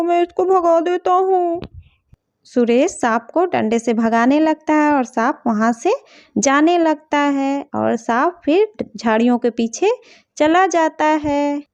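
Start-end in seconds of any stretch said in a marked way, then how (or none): 2.5-11.6 lagere, rustige vertelstem; verhalende vertelstem. A narrator's reading. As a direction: vertel kalm als verteller, los van de personages. Story narration between character voices.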